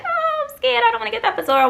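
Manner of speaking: high, wailing mock sob; imitating hysterical crying. Retelling her epidural panic, high mock crying. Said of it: "I'm crying hysterically".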